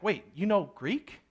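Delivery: puzzled double take, ending on a rising question; taken aback. Acted. Tribune to Paul who speaks perfect Greek, taken aback. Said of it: "it takes him back".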